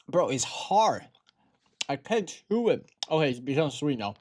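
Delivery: ordinary talk, nothing marked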